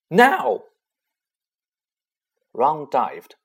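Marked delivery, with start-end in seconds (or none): 0.1-0.6 high, loud go signal; shout. A narrator's reading. Ron signalling the attack, sharp shout. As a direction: bark the signal.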